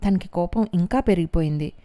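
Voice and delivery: plain reading voice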